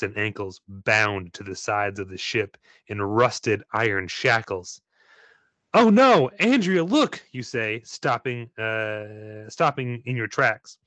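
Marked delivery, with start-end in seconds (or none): 5.7-7.2 higher and louder, sudden alarm; alarmed cry. Acted. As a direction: gasp it out in fright at the skeletons. Player alarmed at skeletons.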